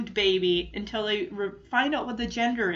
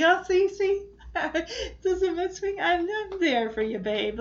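laughing Jesse; 7 semitones higher than usual. Retold or imitated. Jesse's hug retold with laughter.

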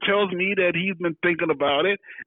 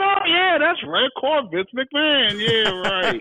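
high-pitched burst of remembering; retold "oh yeah!" moment, high and animated; sudden recollection; 11 semitones higher, slower than usual. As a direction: act out a jolt of memory, pitched way up. Suddenly remembers the call in story.

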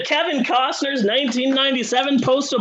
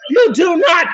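loud, higher indignant cry; mock outraged shout; 5 semitones higher, 6 dB louder than usual. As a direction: bellow it in fake fury. Comic rant, loud.

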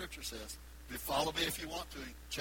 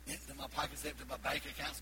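ordinary talk

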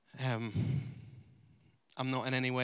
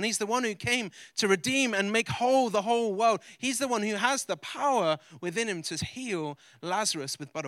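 full-force preaching; 5 semitones higher, 6 dB louder than usual. Acted. Pastor preaching Lazarus to his church, full force. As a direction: preach it big to the whole room.

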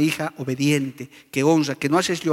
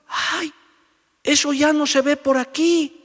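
put-on curious old woman, pitched up; old lady; 10 semitones higher than usual. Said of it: "una señora mayor". (señora mayor curiosa, voz aguda)

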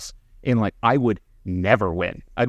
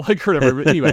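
laughing; 5 semitones higher, 5 dB louder, faster than usual. Real, not acted. Laughing after his own punchline.

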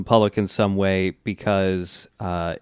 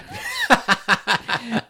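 high laugh; 13 semitones higher, 4 dB quieter than usual. Acted. Laugh after mock narration.

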